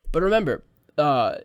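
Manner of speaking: ordinary talk, nothing marked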